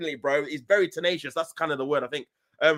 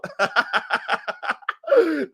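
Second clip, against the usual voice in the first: long high-pitched cackle; sustained helpless laughter; real laugh; 8 semitones higher than usual. Real, not acted. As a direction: burst out laughing at an absurd rumour. Laughing at transfer rumour.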